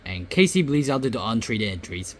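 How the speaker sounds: normal talking voice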